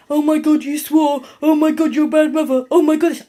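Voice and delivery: a touch of fake scandalised gasping; mock outrage. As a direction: parody the pearl-clutching commenters. Mocking commenters' outrage.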